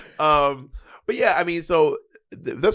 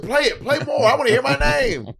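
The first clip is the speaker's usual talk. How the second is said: high-pitched mock demand; hammed-up, high mocking echo; teasing mimicry; 13 semitones higher than usual. Teasing friend by echoing their demand.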